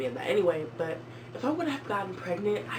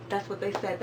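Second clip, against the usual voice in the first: ordinary talk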